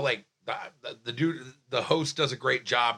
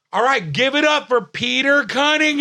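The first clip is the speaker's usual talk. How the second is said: loud, high MC shout, last word held long; imitated stage host; 11 semitones higher, 9 dB louder than usual. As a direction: hype the crowd like a club host. Retold host introducing him to crowd.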